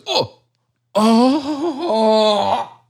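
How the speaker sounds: overacted pained groan; hammy stage actor. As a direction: ham up the agony. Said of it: "It's completely overacting".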